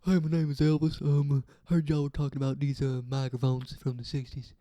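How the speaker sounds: croon-talk like the King, loudly. Acted. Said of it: "That sound like [name]".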